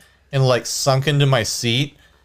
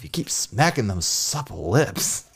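ordinary delivery

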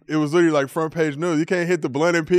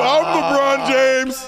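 LeBron impression; 10 semitones higher, 4 dB louder, much slower than usual. Impersonating LeBron tweeting.

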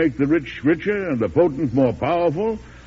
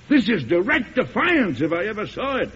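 higher, indignant and affronted; 6 semitones higher than usual. Acted. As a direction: bristle at the defiance. President angered by bank report.

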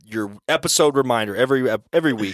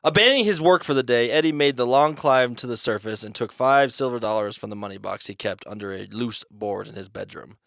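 ordinary delivery

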